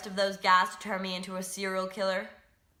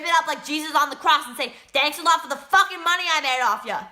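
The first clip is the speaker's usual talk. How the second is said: heated, higher-pitched tirade; real angry rant; 4 semitones higher, 3 dB louder than usual. Real, not acted. Prisoner cursing those who profited from her, angry. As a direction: rail at them all without letting up.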